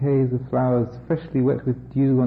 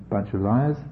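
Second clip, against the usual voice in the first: ordinary delivery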